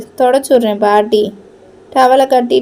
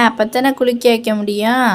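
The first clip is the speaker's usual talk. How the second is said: normal talking voice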